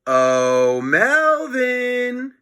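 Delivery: sly sing-song summons; calling out. Acted. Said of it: "he called".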